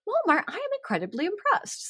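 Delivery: high, gushing mock praise; mock impressed. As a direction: overdo being impressed.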